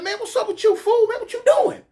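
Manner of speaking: high, indignant disbelief; outraged. Retold or imitated. Spitting out a stick and confronting the cop, high and indignant. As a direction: fire it at the cop in shock.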